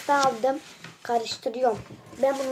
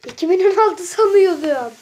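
higher, louder surprised outburst; 7 semitones higher, 10 dB louder than usual. Real, not acted. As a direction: blurt it out in surprise. Surprised at own school year.

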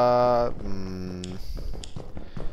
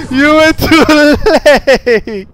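laughing mock accusation; 11 dB louder than usual. Joking after death.

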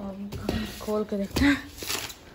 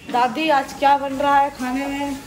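calling out; 5 semitones higher, 7 dB louder than usual. Real, not acted. Calling to grandma in kitchen.

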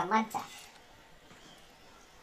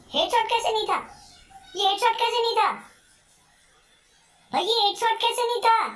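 frustrated outcry; 9 semitones higher than usual. Real, not acted. Shot failed to kill enemy.

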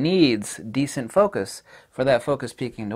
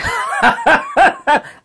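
much higher, loud real laugh that falls away; burst of laughter; 11 dB louder than usual. Real, not acted. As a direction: cracks up at own joke. Laughing at own joke.

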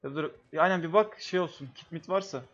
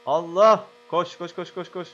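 being chased; 3 semitones higher, 9 dB louder, slower than usual. Real, not acted. Fleeing in game, urging to run.

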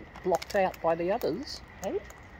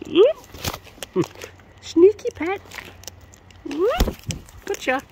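high, louder playful squeals; 13 semitones higher, 4 dB louder, much slower than usual. Acted. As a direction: squeal along as the bird hops about. Playful with the bird.

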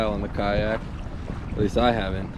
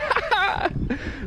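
high-pitched burst of laughter; high cackling laugh; excited laugh; 5 dB quieter than usual. Real, not acted. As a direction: laugh out in delight. Laughs over the catch.

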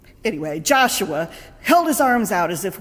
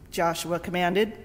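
ordinary talk, nothing marked

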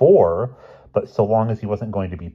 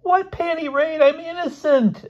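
slow, very high squeaky scream; screamed protest; 4 dB louder than usual. A narrator's reading. Boy denying the raid to his friend, high screamed protest. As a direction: squeal it out in outrage. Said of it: "Elmer screamed".

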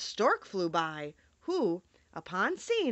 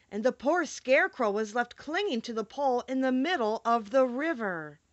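ordinary delivery, nothing marked

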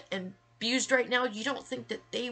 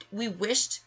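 ordinary talk

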